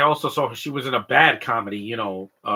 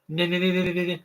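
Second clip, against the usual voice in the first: higher, hammed-up stammer on n; mock stutter; 6 semitones higher than usual. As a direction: imitate a stuck stutter. Joking about a stuttering actor.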